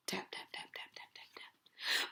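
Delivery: breathy whispered tap tap; whispered typing taps. A narrator's reading. Hacker typing on the keyboard, whispered taps. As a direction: whisper the taps like keys clicking.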